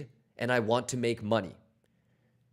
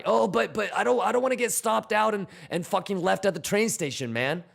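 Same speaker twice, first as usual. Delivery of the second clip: mock whiny trader; 8 semitones higher than usual. Mimicking traders who fear stops.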